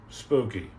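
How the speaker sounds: low answer. Pretend. Streamer answers his own spooky, low and falling.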